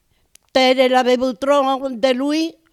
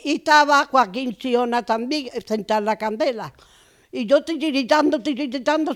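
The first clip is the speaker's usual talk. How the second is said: usual speaking voice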